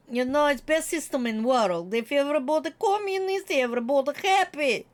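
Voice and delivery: hammed-up Russian accent, fast and clipped grammar; comic Russian accent praising communism; mock Russian accent. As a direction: play a cheery Soviet propagandist with a Russian accent. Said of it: "she is someone who loves communism".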